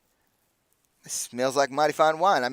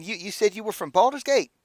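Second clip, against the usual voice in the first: higher character voice; 8 semitones higher than usual. Acted. GM voicing Aldo's question.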